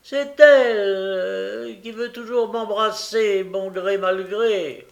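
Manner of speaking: high, loud, whining excuse; tearful protest. Acted. Noé blaming Charlotte to Bumble, tearfully protesting. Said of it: "dit Noé, les armes aux yeux".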